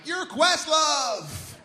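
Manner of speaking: high, drawn-out mock holler; stretched, over-the-top shriek of recognition; excited shout. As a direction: yell it like a thrilled fan spotting a celebrity.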